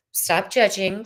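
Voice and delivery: usual speaking voice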